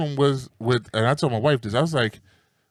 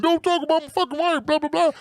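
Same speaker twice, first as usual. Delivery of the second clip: high mock yell of a man dragged out; high-pitched mock screaming; 13 semitones higher than usual. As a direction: imitate him yelling while hauled off. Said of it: "drag you out while you screaming".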